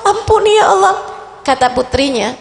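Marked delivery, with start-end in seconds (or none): 0.0-1.0 high, crying plea to God, voice breaking; crying repentant prayer, rising into cries; tearful pleading prayer. Retold or imitated. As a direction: sob out the apology as the mother who missed night prayer. Mother's remorse voiced again.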